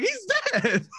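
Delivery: cracking up mid-word; laughing disbelief. Retold or imitated. Retelling a movie scene to friends, laughing disbelief. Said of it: "I'm laughing so hard 'cause I'm like".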